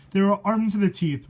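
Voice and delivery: plain reading voice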